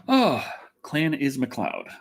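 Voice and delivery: normal talking voice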